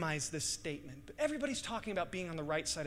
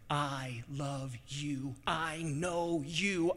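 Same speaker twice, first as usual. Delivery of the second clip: tender Jesus voice; much slower than usual. Acted. Jesus to the believer, slow and tender. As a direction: say it slowly, like holding a face. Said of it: "he's like".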